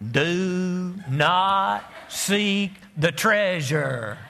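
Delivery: very slow, drawn-out movie prophet voice; slow, ominous film-prophet intonation; Oh Brother quote. Retold or imitated. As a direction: intone it like the blind seer in the film. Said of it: "Everybody in the early service this morning was like".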